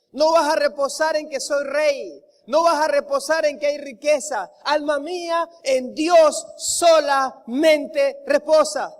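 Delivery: impassioned sermon delivery, hammering the repeats; fervent preaching. Acted. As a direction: drive each line home to the congregation. Preaching at full force.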